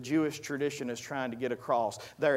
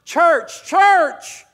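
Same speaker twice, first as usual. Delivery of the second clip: heavy-hearted plea hollered to the church; each "church" higher and louder; crying out with everything; 12 semitones higher, 12 dB louder, much slower than usual. Acted. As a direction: build to a high, loud shout. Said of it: "I cried it with everything in me".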